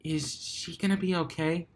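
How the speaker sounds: quiet, worried question; soft and timid. Acted. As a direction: ask very softly. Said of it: "asked softly".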